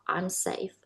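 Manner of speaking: soft self-talk to calm down; quiet self-reassurance. Retold or imitated. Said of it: "I started telling myself".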